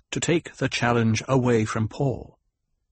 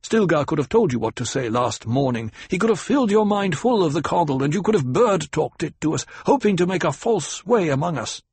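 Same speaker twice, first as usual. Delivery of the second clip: angry accusation; 7 semitones higher, 3 dB louder than usual. A narrator's reading. Jameis accuses Jessica angrily.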